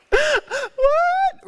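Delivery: breathy laugh leaping into a high, loud what; shrieking what; shocked squeal. Real, not acted. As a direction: disbelief at the memory, squealed out. Reliving the absurd moment.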